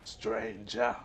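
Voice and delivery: lightly mimicked merchant greeting, drawn out; imitating the merchant. Player parroting the game merchant's greeting, mock merchant voice. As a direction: echo the merchant's call, lilting up at the end.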